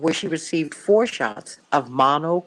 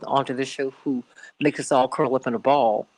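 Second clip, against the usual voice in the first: usual speaking voice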